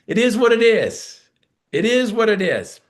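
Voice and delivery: high, loud shrugging brush-off; imitating a worker's excuse; mocking the excuse. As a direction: toss it off like it's settled. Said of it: "You've heard that excuse".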